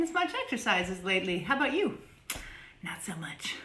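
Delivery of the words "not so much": softly whispered aside. Real, not acted. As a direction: whisper it under your breath.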